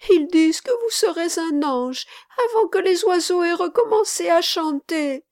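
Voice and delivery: put-on high, loud, pleading child; distressed little boy imploring a friend to stay. A narrator's reading. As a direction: beg urgently in a small boy's voice. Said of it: "reprit le petit garçon".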